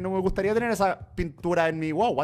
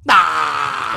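man down shout; 6 dB louder, much slower than usual. Real, not acted. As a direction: scream as you fall to death. Character dies.

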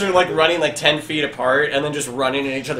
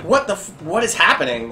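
mildly voiced, higher-pitched disbelief; flabbergasted; 5 semitones higher, 4 dB louder than usual. Town elder staring at the scene, mildly flabbergasted. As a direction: ask it with a touch of shock. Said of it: "he's just flabbergasted".

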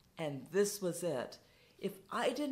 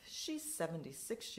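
usual speaking voice

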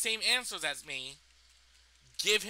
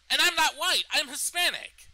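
indignant outburst; 6 semitones higher, 5 dB louder than usual. Real, not acted. Indignant correction, voice raised.

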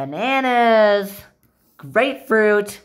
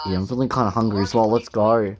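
low doll voice; 13 semitones lower, faster than usual. Pretend. Toy character voiced low.

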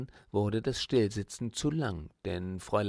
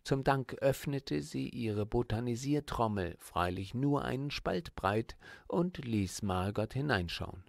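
ordinary delivery, nothing marked

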